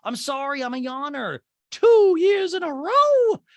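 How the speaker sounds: very high mock-affronted voice, building loud; hammed-up squeaky impersonation; Dorit. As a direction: caricature her, stretching the final words. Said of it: "And Dorit's like".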